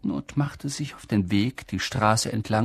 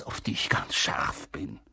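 confiding whisper; 9 semitones lower, 7 dB quieter than usual. Acted. Whispered aside.

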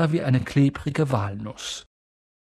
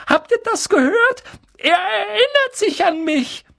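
overjoyed cry; 4 dB louder than usual. A narrator's reading. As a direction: crow it to the whole room. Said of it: "rief Daedalus Dickel und blickte in die Runde".

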